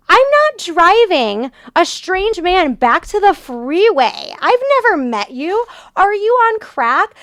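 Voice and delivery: high, slow, outraged; indignant rant. Real, not acted. Refusing the driver's offer, high slow outrage. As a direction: spell out the outrage slowly.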